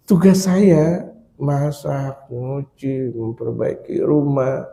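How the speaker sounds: slow, soft, patient listing; acted mild-mannered husband, hushed and unhurried; calm, smiling explanation. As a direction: explain gently, drawing out each chore. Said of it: "senyum. Begini".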